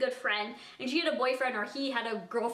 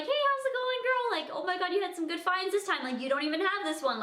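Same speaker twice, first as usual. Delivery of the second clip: much higher, chirpy cashier hello; bubbly greeting; 7 semitones higher than usual. Retold or imitated. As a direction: gush over her good finds. Greeting favourite regular customer.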